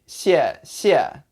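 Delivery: "xie xie" as two separate, equal falls; slow, evenly stressed "xie, xie"; two full falling tones. Shown as a demonstration. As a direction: give each "xie" its own full drop. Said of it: "you don't say".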